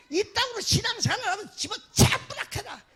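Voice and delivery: high, loud scolding in a Gyeongsang dialect; mock shouting. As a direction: yell at the absent member like an angry deaconess. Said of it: "소리를 막 질러요". (경상도 사투리로 호통치는 흉내)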